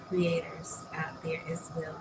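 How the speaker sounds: whispered good night. Real, not acted. Creator whispering good night to content creators. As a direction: hush it like a bedtime goodbye.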